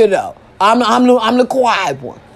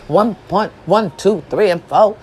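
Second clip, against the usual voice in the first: ordinary delivery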